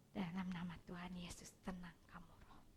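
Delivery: soft unvoiced whispered prayer over a child. Shown as a demonstration. Counselor showing how she whispers a calming prayer to a child. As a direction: breathe the prayer in a hush. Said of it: "saya biasa pakai doa".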